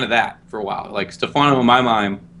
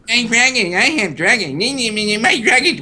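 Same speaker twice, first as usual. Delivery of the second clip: high, whiny mocking impression; Khaleesi; 9 semitones higher than usual. Whiny queen fretting over her lost dragons, high mock whining. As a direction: whine it in a sing-song, exaggerated high voice. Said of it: "she was a whiny bitch".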